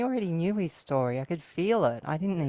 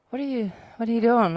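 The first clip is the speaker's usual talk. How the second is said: louder mock protest, a little fast; startled protest; 5 semitones higher, 5 dB louder, faster than usual. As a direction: push back at being shoved. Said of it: "I was like".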